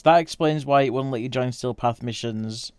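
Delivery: normal talking voice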